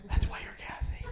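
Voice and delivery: whispered jab. Acted. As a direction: whisper it as a teasing aside. Whispered punchline.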